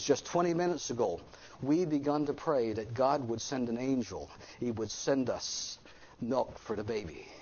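low, hushed account of praying; retold desperate prayer. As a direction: recount the plea softly and low. Father retells his desperate prayer.

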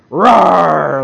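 little bear rearing up and roaring at the panther; big bear roar. Shown as a demonstration. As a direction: roar like a bear protecting its cub. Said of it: "like this".

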